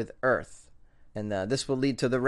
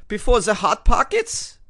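loud, high, slower mock-German question; German accent; 8 semitones higher, 7 dB louder than usual. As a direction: repeat it incredulously in a hammed-up German accent. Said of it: "This is my German accent".

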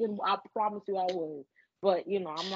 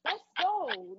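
higher-pitched, lightly acted order to a kid; brisk parent send-off; 6 semitones higher than usual. Mom to little one at school drop-off, brisk send-off.

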